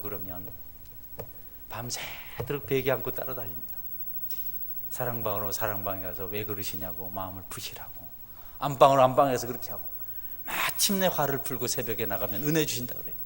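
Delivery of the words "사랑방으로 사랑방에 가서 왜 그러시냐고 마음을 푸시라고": whispered, coaxing the upset wife; soothing whisper. Retold or imitated. Pastor coaxing his angry wife to calm down, whispering. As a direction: hush it like calming a spouse at night.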